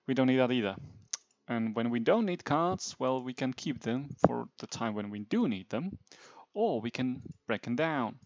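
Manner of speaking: usual speaking voice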